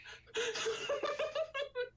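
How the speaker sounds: high-pitched burst of laughter. Real, not acted. Reviewer reacting to the smash attempt, high laugh. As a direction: crack up in a high giggle.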